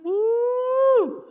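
a sudden high whoop; hammed-up squeal of delight; mock glee. Mock glee after secret plan.

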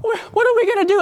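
high, whining complaint with a cry in it; mock the grumbling people, high and tearful; whiny Israelites. Preacher mimics Israelites complaining of thirst, high whine. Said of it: "And they're all like".

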